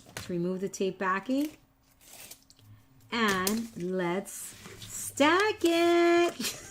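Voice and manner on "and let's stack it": very slow, laughing through it. Real, not acted. Crafter cracks up mid-instruction to her viewers. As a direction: let the laugh take over the words.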